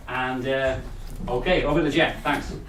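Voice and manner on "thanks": normal talking voice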